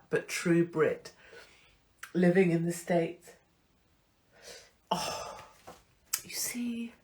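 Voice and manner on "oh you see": tearful whisper. Real, not acted. Crying whisper.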